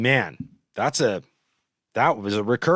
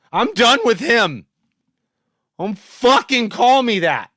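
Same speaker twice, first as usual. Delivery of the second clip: loud, higher, real fury, hammering each order; angry demands; 8 semitones higher, 7 dB louder than usual. Real, not acted. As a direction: bark at the moderator to ban him. Ordering the moderator to ban him.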